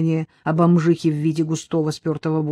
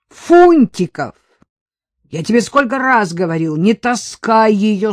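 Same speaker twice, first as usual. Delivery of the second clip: shout; 4 semitones higher, 7 dB louder than usual. Acted. Sergeant bellowing for his junior officer, loud call.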